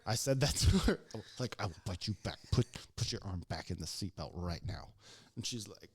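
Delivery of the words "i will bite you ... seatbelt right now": low-pitched stern warning; retold firm order, held back; mad, restrained threat. Fed-up EMT orders patient back into seatbelt, low restrained threat. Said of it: "I'm getting mad".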